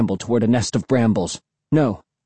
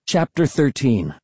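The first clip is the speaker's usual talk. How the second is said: ordinary delivery, nothing marked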